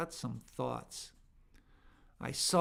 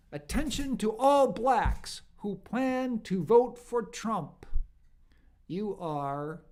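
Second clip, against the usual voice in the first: loud, raised announcement voice; proclaimed sign reading; 7 semitones higher, 7 dB louder than usual. Retold or imitated. Commentator quoting a church sign to listeners, mock proclamation. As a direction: read it out like a public notice.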